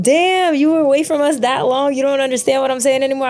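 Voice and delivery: high-pitched teasing exclamation; friends' mock disbelief. Guest voicing UK friends she no longer understands, high mock disbelief. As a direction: act out a friend's amazed ribbing.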